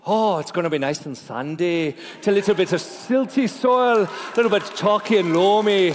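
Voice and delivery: playful put-on Irish lilt; mock Irish tasting notes; Irish accent. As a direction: describe it with a jokey Irish accent. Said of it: "Let's do it with Irish".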